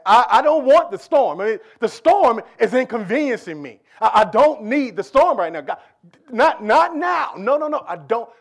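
loud, high whiny protest; mock frustrated complaint. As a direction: complain loudly about the storm. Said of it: "the last thing I wanted to see was a storm".

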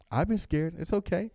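ordinary talk, nothing marked

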